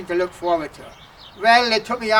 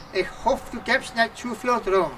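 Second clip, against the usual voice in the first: ordinary talk, nothing marked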